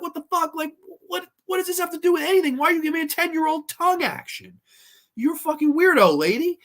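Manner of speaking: high, fast appalled outburst; outraged rant. Retold or imitated. Reviewer scolds the babysitter character, high and fast. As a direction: berate the character in disgust. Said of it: "I'm like".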